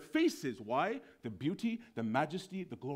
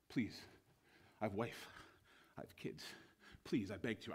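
soft, halting begging; quiet, desperate appeal with pauses; frightened pleading; 5 semitones lower, 8 dB quieter than usual. Acted. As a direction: plead for your life in broken phrases. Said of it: "one of the ten is freaking out".

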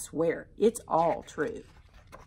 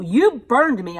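put-on angry kid; 5 semitones higher, 8 dB louder than usual.

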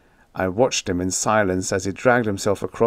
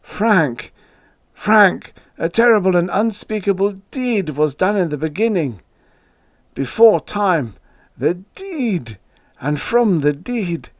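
high, loud frantic outcry; frenzied, horror-struck declaiming; hysterical scream; 9 semitones higher, 4 dB louder than usual. A narrator's reading. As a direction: cry it out in rising hysteria. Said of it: "His voice had risen to a scream".

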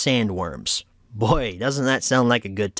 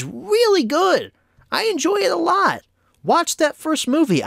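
pitched way up, pushing each word hard; emphatic praise; 14 semitones higher than usual. Real, not acted. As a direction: sell it with big high excitement. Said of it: "I can't emphasize that enough".

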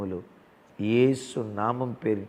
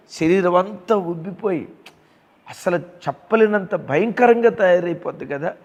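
raised, fervent preaching voice; loud sermon exhortation; 6 semitones higher, 6 dB louder than usual. Acted. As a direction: press the promise home to the flock. Pastor preaching to congregation.

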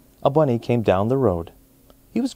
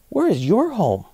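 much higher eager bunny voice; bunny asking; 9 semitones higher than usual. A narrator's reading. Bunny asks the other bunny, much higher. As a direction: ask brightly as a little bunny. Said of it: "he asked the bunny".

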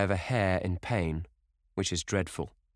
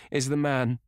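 higher, hesitant question left hanging; unable to finish; 7 semitones higher, 4 dB louder than usual. A narrator's reading. Dorian asking Lord Henry about the victim, breaking off. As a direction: break off before the dreaded word. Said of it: "He could not finish the sentence".

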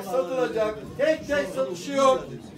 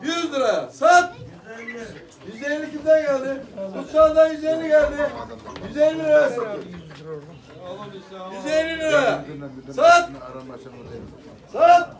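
loud rhythmic auction call; auctioneer; 3 dB louder than usual. Acted. Auction of birds, calling bids.

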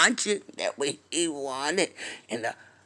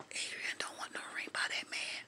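whisper; 16 dB quieter than usual. Real, not acted. Gossip aside to viewers.

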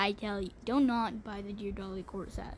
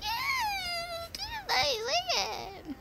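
much higher, whiny mocking voice, slow; mock fake praise; much slower than usual. Imitating viewers teasing her choice, mocking whine. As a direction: mimic a sarcastic viewer, crying-whine. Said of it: "everyone's going like".

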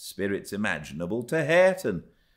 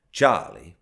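plain reading voice

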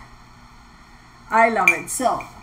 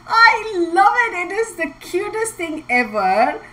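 gushing; 8 semitones higher, 3 dB louder, slower than usual. Real, not acted. Gushing over gift.

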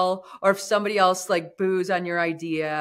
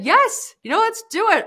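eager yes; 13 semitones higher, 5 dB louder than usual. Retold or imitated. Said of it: "So I said".

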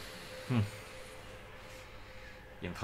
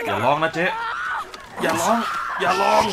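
building shout, higher and louder each time; 8 semitones higher, 7 dB louder than usual. Real, not acted. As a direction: yell at her to stop screaming. Shouting at the screaming woman.

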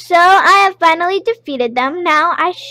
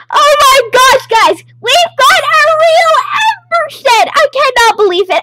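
much higher, loud thrilled shout; excited; 12 semitones higher, 7 dB louder than usual. Real, not acted. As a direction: squeal with joy at the find. Finally gets the rare pet.

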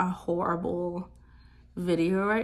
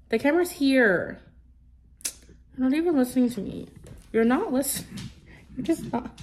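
coaxing pet voice; 5 semitones higher, 4 dB louder than usual. Real, not acted. Coaxing dog to greet camera.

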